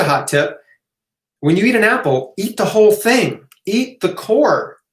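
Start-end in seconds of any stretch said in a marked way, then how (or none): 1.4-4.8 higher, insistent push to eat the whole apple; emphatic hot tip. Real, not acted. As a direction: urge them to eat the core, pitch lifted. Hot tip urging viewers.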